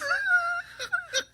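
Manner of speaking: high laugh near crying; shrieking laugh. Real, not acted. Laughs hard.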